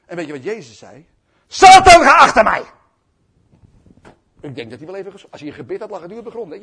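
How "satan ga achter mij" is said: booming, high shouted command; thundering outburst; sudden stern rebuke. Retold or imitated. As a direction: explode into a fierce rebuke. Jesus rebukes Peter, acted.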